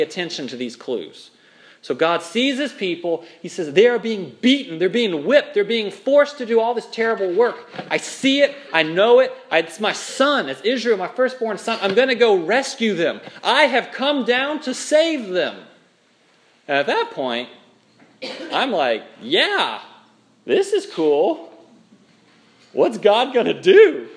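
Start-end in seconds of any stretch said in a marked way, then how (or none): 3.7-15.6 louder, higher, rising urgency; impassioned God. Acted. God seeing his beaten people and vowing rescue, impassioned. 19.2-24.1 higher, louder eager cheer; hyped up, punching the question; pumped-up anticipation. Acted. Preacher reacting to God's rescue plan, pumped up.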